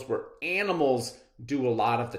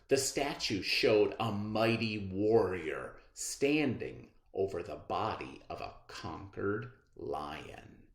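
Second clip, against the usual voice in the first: ordinary delivery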